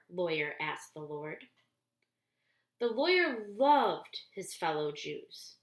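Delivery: plain reading voice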